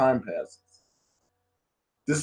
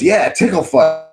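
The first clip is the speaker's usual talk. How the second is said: higher, louder playful cheer; imitated eager insistence; 7 semitones higher, 5 dB louder than usual. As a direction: insist like an excited brat. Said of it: "And she goes".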